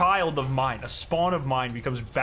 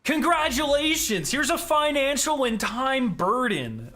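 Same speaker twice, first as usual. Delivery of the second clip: mock announcement; 10 semitones higher, slower than usual. Sarcastic mock gift announcement.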